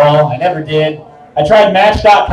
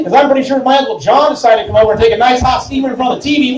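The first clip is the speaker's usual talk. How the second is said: usual speaking voice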